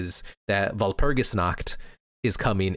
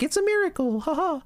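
put-on much higher, giddy anime girl; chirpy girl voice. Sayaka cheering that Kyosuke got better, high and chirpy.

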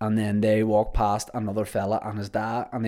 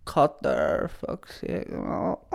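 muttered aside; 4 semitones higher, 6 dB quieter, much slower than usual. Real, not acted. Aside to producer.

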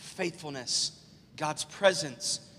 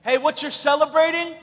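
high, loud interrupting call; sudden raised-voice challenge; shouting to a crowd; 9 semitones higher, 10 dB louder than usual. Retold or imitated. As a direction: holler it over a celebrating crowd. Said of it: "a man stands up and says".

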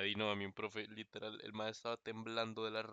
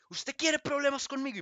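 grito agudo y fuerte de profe furioso; profe gritando; 9 dB louder than usual. Retold or imitated. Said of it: "Y me grita". (el profe le grita al alumno, agudo y fuerte)

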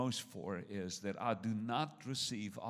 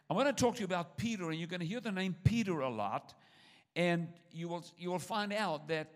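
preaching voice; 7 semitones higher, 5 dB louder than usual. Real, not acted. Preaching at full force.

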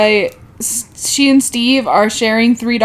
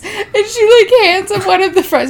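excited retelling; 10 semitones higher than usual. Real, not acted.

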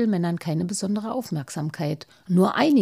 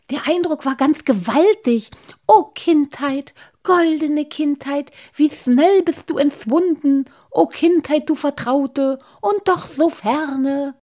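higher, louder, effusive and dreamy; blissful; 8 semitones higher, 6 dB louder than usual. Acted. Halbschuh rhapsodizes about his childhood, blissful. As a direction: sing out a hymn to lost childhood. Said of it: "nickte Halbschuh selig".

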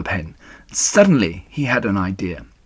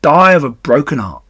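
lightly put-on, louder echo; mocking mimicry; 4 semitones higher, 6 dB louder than usual. A narrator's reading. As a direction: throw the plea back in scorn. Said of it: "he mimicked".

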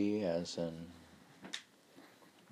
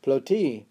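"Ploti" as plo-TEE, punched louder; stress on the T; 5 semitones higher, 9 dB louder than usual. Shown as a demonstration. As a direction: hit the second syllable of "Ploti". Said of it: "How do I know it's on the T".

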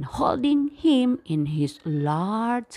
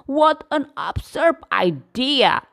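louder, mocking lion disbelief; scornful; 6 dB louder than usual. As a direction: scoff at the tiny mouse. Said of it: "he said scornfully".

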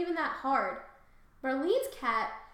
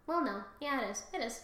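ordinary talk, nothing marked

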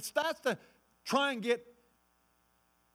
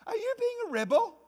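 much higher, sneering taunt; mocking devil; 4 dB louder than usual. Devil questioning the believer's right, high mocking taunt. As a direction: needle them as the accuser.

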